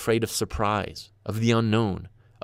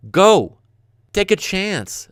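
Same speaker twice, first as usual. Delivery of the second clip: loud, high burst of encouragement; emphatic shout; 7 semitones higher, 7 dB louder, much slower than usual. Real, not acted. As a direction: fire it out as a rallying cry. Urging the listener to travel.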